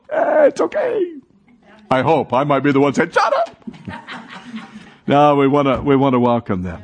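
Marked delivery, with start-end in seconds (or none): 0.1-1.1 mock easygoing. Pastor voicing his own calm reply. 3.1-3.6 self-mocking snap. Pastor voicing himself snapping. 3.7-4.2 short airless chuckle; breathy unvoiced laugh. Real, not acted. Laugh after joke.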